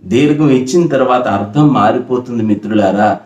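ordinary talk, nothing marked

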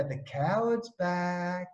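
slow, stretched mock heckle; drawn-out taunt. Heckler turning up behind the nets, slow taunting call. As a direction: drag out each word to taunt him.

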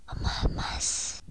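ordinary talk, nothing marked

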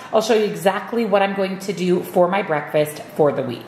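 ordinary talk

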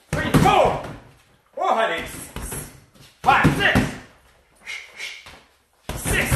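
high, forceful trainer counts; real mid-workout exertion in the calls; drill call-outs. Real, not acted. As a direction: push the class through the set, numbers punched out high. Driving class through hard set.

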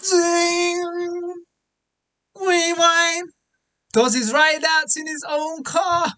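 high, amazed hype; excited rewind call. Real, not acted. Hyped replay call after diss.

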